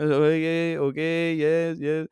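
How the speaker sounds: put-on phony Euro accent, meekly agreeing; put-on Euro trash accent; fake accent. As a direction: nod along in a fake European accent. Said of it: "with my fake accent".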